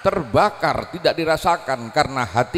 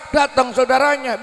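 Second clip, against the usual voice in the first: ordinary talk, nothing marked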